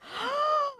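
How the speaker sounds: sharp high gasp of shock. Real, not acted. Streamer gasps at a chat message, sharp gasp. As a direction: gasp at what chat just said.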